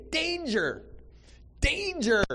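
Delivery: high-pitched alarm call; warning sign. Pretend. Preacher voicing a neon warning sign, high alarm. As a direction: cry it out like a flashing warning sign. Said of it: "a big neon sign above me".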